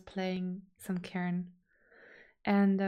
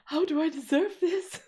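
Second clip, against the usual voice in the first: overwhelmed disbelief; 3 semitones higher, 5 dB louder than usual. Retold or imitated. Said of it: "I was like".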